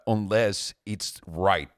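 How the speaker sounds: ordinary talk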